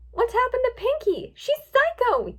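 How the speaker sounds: high, loud frantic cry; horrified yell. A narrator's reading. Twilight fleeing from Pinkie, horrified yell. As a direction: yell it in shock. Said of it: "yelled Twilight".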